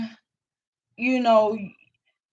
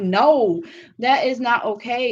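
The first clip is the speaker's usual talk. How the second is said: louder, slow, emphatic refusal; firm no; 3 semitones higher, 7 dB louder than usual. Real, not acted. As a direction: put your foot down. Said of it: "It's like".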